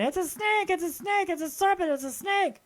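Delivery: high-pitched imitated cry of alarm at the same level; retold excited scream. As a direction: imitate Pam shrieking "snake!" in alarm. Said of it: "she's screaming".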